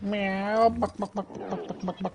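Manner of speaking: high mock chicken clucks, "buck, buck"; clucking chicken. Streamer mimics a chicken for his gaming friend, high mock clucks. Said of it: "one of those French Canadian chickens".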